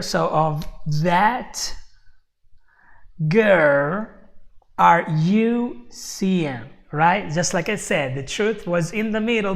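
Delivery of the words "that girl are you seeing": put-on "that", "girl", "you", "seeing" drawn out slowly, set apart by pauses; stressed key words. Shown as a demonstration. Teacher breaking down a quote for students, slow stress on "that girl", "you seeing". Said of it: "choose to emphasize these particular words".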